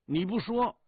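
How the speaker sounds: high, loud retort; loud protest. Acted. Soldier challenges squad leader on the topic, loud protest. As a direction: throw it back at him, high and loud.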